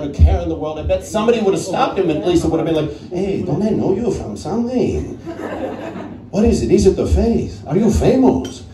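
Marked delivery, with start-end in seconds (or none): 3.1-8.6 a slow passerby, laughing, squinting in recognition; curious passerby. Acted. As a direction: puzzle over a half-familiar face.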